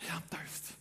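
faint breathless whisper fading away; weak whisper. Acted. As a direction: gasp it out, parched and dying. Dying Jesus on the cross.

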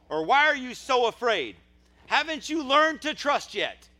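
high, loud, drawn-out rebuke; impassioned preacher voicing a fed-up Lord; frustrated shout. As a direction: yell each question slowly in exasperation. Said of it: "I think he's just as frustrated".

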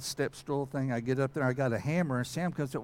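ordinary delivery

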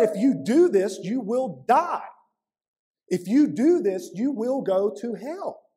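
loud, high, slowed warning; stern warning. Retold or imitated. Preacher booming Paul's warning to sinners, loud and slow. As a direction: thunder the warning from the pulpit.

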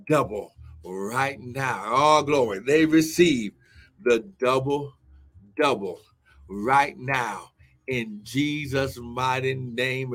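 low, intoned prayer with weighty pauses; deep, deliberate declaration over the congregation; solemn prayer declaration. Real, not acted. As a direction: pronounce the blessing phrase by phrase, low and measured.

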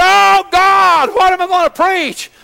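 high, loud anguished shout; desperate cry to God. Retold or imitated. Pastor retelling his plea to God, high anguished shout. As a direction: cry out to heaven in panic.

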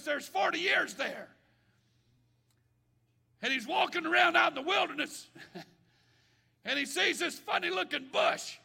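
loud, high preacher's cry; preaching. Acted. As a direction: preach it out to the church. Preaching at full force.

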